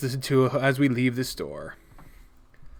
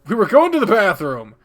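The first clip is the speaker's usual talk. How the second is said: loud, raised-pitch cover story; blurted excuse; 5 semitones higher, 8 dB louder than usual. Acted. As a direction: blurt it out to cover for a frozen friend.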